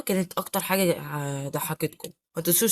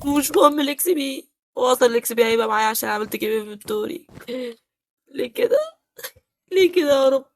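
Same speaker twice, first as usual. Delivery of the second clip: mock crying; 7 semitones higher than usual. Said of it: "بطل عياط".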